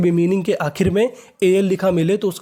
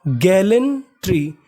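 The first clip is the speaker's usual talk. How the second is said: Gallantry drawn out syllable by syllable; slow, careful demo; much slower than usual. Shown as a demonstration. Teacher slowly pronounces gallantry for students. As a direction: stretch out gallantry for the class. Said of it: "तो meaning बनी".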